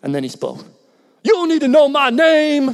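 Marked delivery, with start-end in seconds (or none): 1.2-2.7 higher and louder, cold street defiance; tough-guy thief voice. Retold or imitated. Thief refusing to tell the pastor his name, defiant. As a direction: spit it back like a hostile stranger.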